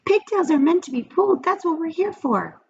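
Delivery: pigtail voice. A narrator's reading. The pigtail coaxes Paul in a high little voice. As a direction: coax him like a tiny tempter. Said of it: "said the pigtail".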